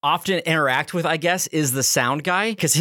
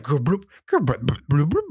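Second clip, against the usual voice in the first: stomach gurgle imitation. Telling a friend how the stomach gurgled, imitated noises. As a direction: mimic a churning upset stomach.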